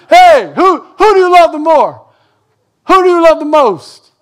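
put-on high, loud pleading child; kid asking parents. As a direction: whine up at mom and dad like a kid. Kid asks mom and dad.

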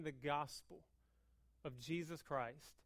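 soft, low, sincere delivery; earnest quiet preaching. Real, not acted. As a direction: drop down and mean it.

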